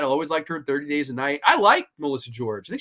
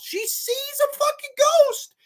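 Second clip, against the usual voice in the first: much higher, slow, stunned exclamation; disbelief; slower than usual. Real, not acted. Reviewer stunned the realtor ignores a ghost, high and slow. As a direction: drag out the disbelief.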